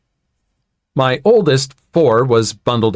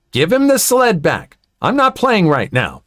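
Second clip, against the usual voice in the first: high-pitched, insistent demand; stern demand; 7 semitones higher than usual. A narrator's reading. Mother to woman holding son's sled, stern demand.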